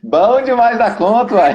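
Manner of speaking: high, laughing Minas Gerais reply; mineiro accent. Acted. Host laughing back at the teacher in a Minas Gerais accent. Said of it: "Dá aquela variação linguística".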